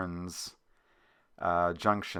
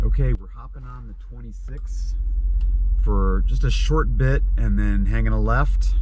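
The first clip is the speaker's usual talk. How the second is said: road noise; 11 dB louder than usual. Real, not acted. Recorded from moving vehicle.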